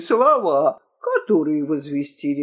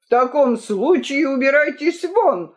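mildly raised, indignant command; higher, angry order to get out; angry shout; 7 semitones higher than usual. A narrator's reading. Angry king dismissing the courtiers, indignant shout. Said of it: "крикнул разгневанный Толстопуз".

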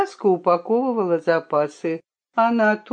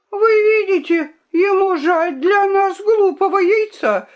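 high, loud squeaky accusation; squeak; 12 semitones higher, 4 dB louder than usual. Acted. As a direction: squeak it out like a spoilt princess. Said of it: "пискнула королевна".

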